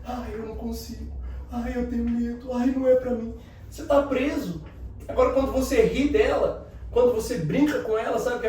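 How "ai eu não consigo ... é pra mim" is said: acted helpless moaning; defeated self-doubter. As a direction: play someone giving in to the inner critic. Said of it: "você fica assim".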